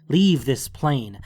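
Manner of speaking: ordinary delivery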